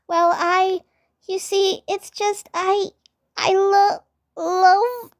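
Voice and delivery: put-on shy girl voice, halting; flustered stammer. A narrator's reading. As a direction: stammer the confession.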